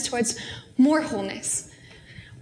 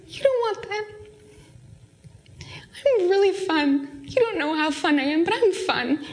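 playful lady; 4 semitones higher than usual. Retold or imitated. Dream lady pleading in story.

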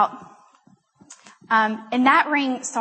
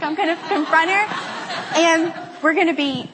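higher-pitched mock determination with laughter; hyped-up resolve; 5 semitones higher than usual. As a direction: pump yourself up for a confrontation, half laughing. Hyped-up resolve, second retelling.

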